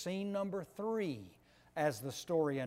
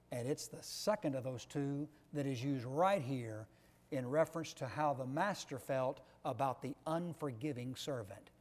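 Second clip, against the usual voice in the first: ordinary talk, nothing marked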